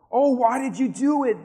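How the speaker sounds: loud, high lament of dismay; acted groan. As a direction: groan it out as the exasperated sailors. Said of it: "they groaned".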